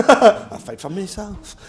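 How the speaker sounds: slow, high, loud laughing self-praise; hammed-up vain voice through laughter; mock narcissist. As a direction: lampoon a self-obsessed person. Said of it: "you narcissistic bastards".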